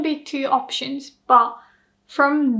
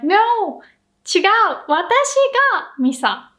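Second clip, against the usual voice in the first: a sharp high No, then 私がMisa laid down firmly; indignant denial; 8 semitones higher than usual. Retold or imitated. Teacher acts out confronting an impostor, indignant. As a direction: set the impostor straight. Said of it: "And I'm like".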